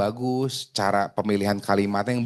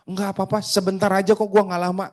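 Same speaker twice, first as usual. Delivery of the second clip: high-pitched pushy agent's plea; insistent pleading; 8 semitones higher than usual. Acted. Agent pressing a prospect to meet briefly, insistent pleading.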